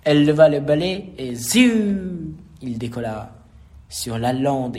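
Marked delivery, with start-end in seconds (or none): 1.3-2.4 high, long falling whoosh. A narrator's reading. Narrator as the broom takes off again, whooshing. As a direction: send it up high and let it glide down.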